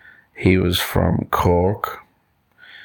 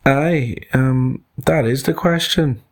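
lightly voiced animals, pitched up; a hint of cartoon animals agreeing; kangaroo and elephant; 7 semitones higher than usual. Two animals agree with monkey, lightly voiced and higher. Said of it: "said Mister Kangaroo and Mister Elephant".

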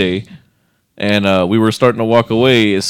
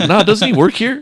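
mock shop guy; 11 semitones higher, 4 dB louder than usual. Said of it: "more like".

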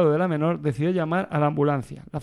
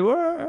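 shout cue; 12 semitones higher than usual. Shown as a demonstration. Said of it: "¿aquí al grito?".

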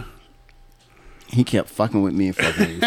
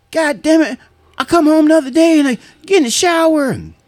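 very high-pitched voice of an indignant coworker; storytelling coworker; 3 dB louder than usual. Retold or imitated. Coworker recounting his shower mishap to workmates, high-pitched retelling.

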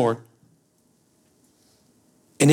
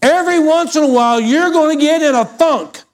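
high, loud preaching; 11 semitones higher, 4 dB louder than usual. Acted. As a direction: preach it up with force. Preaching at full force.

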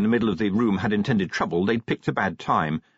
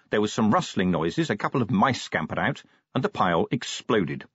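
usual speaking voice

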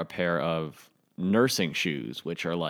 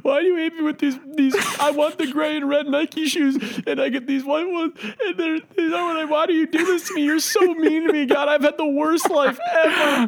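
much higher, louder sobbing whine with laughs; fake-crying kid; 3 dB louder than usual. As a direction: blubber at God over the wrong shoes. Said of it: "I'm, like, sixth grade".

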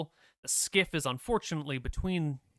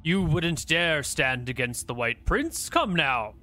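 stern cleric; 5 dB louder than usual. Acted. Cleric voiced by game master.

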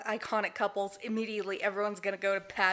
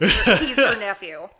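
laughing; 9 dB louder, slower than usual. Real, not acted. Laughing correction.

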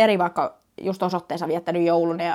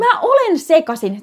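indignant echo; 7 semitones higher, 6 dB louder, slower than usual. Real, not acted. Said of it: "[name], sä oot sekasin".

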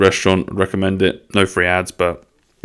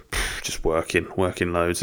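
mock tired mumble; 2 semitones lower, 9 dB quieter, slower than usual. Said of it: "people say".

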